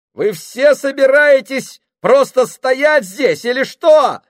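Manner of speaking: high, loud, slow furious shout. Acted. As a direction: yell at the crowd to move. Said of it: "закричал он на них".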